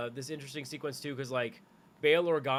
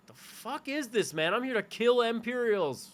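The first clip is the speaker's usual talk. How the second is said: incredulous scoff; 8 semitones higher than usual. Retold or imitated. Acting Cassian incredulous.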